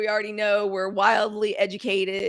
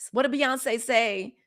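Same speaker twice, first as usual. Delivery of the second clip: ordinary talk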